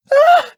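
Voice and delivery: a high, loud sighing cry, nearly a scream; sigh almost a scream. A narrator's reading. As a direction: let the sigh break into a scream. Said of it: "a sigh that was almost a scream".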